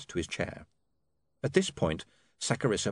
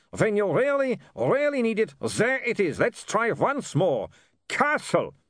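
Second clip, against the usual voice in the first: put-on high, excited vampire with foreign accent; exultant vampire; 13 semitones higher, 4 dB louder, slower than usual. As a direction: crow with delight in a vampire accent. Vampire exulting at his thunder.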